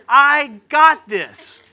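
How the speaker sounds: slow, loud, high mock gangsta through laughter; thug life swagger. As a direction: strut in like a movie tough guy. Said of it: "I did my own little thug life".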